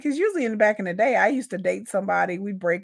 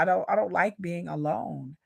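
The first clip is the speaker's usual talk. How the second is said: ordinary delivery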